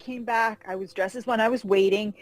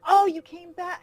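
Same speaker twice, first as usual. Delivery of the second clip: delighted greeting; 11 semitones higher, 5 dB louder than usual. Retold or imitated. Comic legend greets returning fan.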